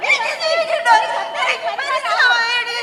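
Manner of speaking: high, loud, breaking into sobs; crying loudly, sobbing through the words; sobbing wail. Acted. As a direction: wail it out in despair.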